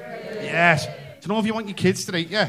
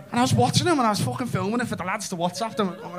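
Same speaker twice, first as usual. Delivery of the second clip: ordinary delivery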